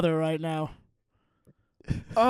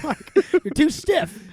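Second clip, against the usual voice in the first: mock director; 8 semitones higher, 4 dB louder, slower than usual. Mock director coaching Zuckerberg.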